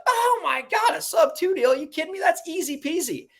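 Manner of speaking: high, incredulous, easy-going voice; hammed-up lawyer laughing it off; mock amazed attorney. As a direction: scoff that it's nothing at all. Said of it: "And he's like".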